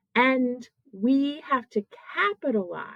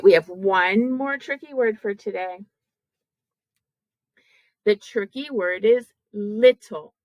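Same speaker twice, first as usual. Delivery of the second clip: ordinary talk, nothing marked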